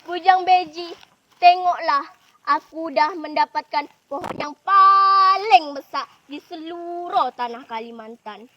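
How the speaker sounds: laugh, then brag loudly, stretching the biggest words; triumphant storybook bragging; gloating boast. Acted. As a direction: show off to your rival with swelling emphasis. Character boasts to rival after laughing.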